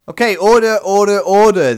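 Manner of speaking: high, loud parliamentary order call; Speaker calling order. Acted. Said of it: "in the House of Lords".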